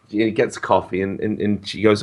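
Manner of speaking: usual speaking voice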